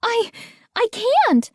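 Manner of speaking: high, halting stammer. A narrator's reading. Boy stammers at his shouting dad, scared. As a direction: stumble over the words, scared. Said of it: "I stammered".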